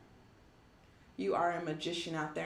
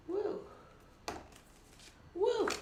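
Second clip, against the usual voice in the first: high, delighted whoop; excited woo. Real, not acted. Reader whooping with joy at her tarot pull. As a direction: cheer it out, pleased with the card. Cheering at a good card.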